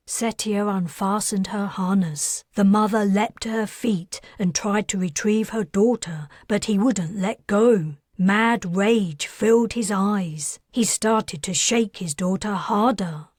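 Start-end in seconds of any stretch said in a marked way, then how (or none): none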